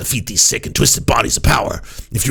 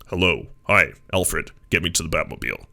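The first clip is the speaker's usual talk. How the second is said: lower, cartoon-hero Batman voice; animated Batman voice; 6 semitones lower, 4 dB quieter than usual. Shown as a demonstration. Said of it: "the animated Batman".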